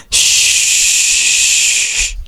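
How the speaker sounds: long hissed swoosh; voiceless cape-flying whoosh; imitated whoosh. Sound effect for Kimmy's cape, whooshed.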